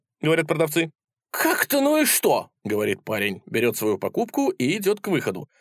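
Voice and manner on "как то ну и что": high, incredulous comeback; indignant retort. A narrator's reading. Lad answers the indifferent sellers, indignant retort. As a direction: throw the question back, pitched high.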